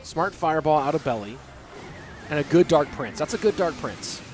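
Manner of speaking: usual speaking voice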